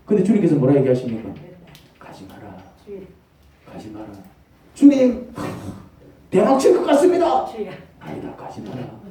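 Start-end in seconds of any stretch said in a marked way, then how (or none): 2.0-4.1 much softer, hushed command; soft divine warning. Acted. God's voice warning softly. 4.7-7.5 high, loud, eager plea; greedy excitement. Acted. Greedy believer pleading with God. 8.0-9.0 whispered divine no; whispered warning. Acted.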